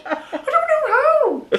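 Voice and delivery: very high, slow squeaky panic; high-pitched mock helplessness; flustered sister. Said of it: "she was like".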